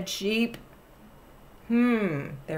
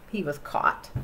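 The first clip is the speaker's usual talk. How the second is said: usual speaking voice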